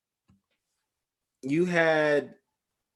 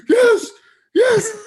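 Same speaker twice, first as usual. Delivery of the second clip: high, loud, strained cry of joy; excited reenacted cheer; 8 dB louder than usual. Retelling almost standing up in the theater, excited cheer. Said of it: "I almost stood up. I was like".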